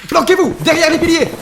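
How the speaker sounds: panicked command; urgent shout. Acted. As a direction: yell at the team to take cover. Ambush, boss yells to take cover.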